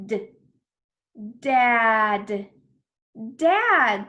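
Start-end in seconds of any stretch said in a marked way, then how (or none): 1.4-2.5 Dad slowly, with a long vowel; drawn-out blending. Shown as a demonstration. Teacher blends letter sounds, Dad drawn out. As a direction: stretch every sound of Dad loudly.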